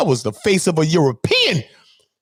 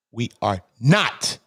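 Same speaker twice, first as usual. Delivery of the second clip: normal talking voice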